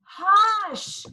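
long high drawn-out hush; a whole crowd shushing as one; town scolding. A narrator's reading. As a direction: townsfolk scolding Holler, stretched hush. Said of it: "the whole town scolded".

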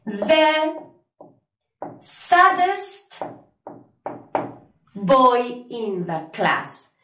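teacher modelling the answer for students; slow model sentence. Shown as a demonstration. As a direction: read it out slowly, lifting saddest. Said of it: "vamos a decir".

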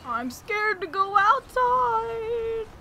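high, slow put-on fearful voice; high, slow whimpering pretend fear; mock scared voice. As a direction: play a scared little child. Repeats line in new voice.

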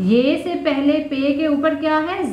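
ordinary talk, nothing marked

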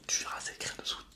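ordinary delivery

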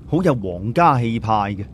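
plain reading voice

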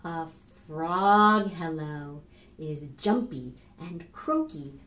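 plain reading voice

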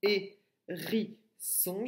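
"Hérisson" stretched very slowly, syllable by syllable; teacher slowing the word for kindergarteners; slow syllable demo. Shown as a demonstration. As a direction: drag out "Hérisson" so a child hears each part. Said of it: "et j'enlève la syllabe ri".

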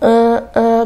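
drawn-out demonstrative "uh"; filler-word hesitation. Shown as a demonstration. Teacher shows learners filler words, as drawn-out hesitation. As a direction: stall on a long, level filler sound. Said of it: "work on reducing filler words".

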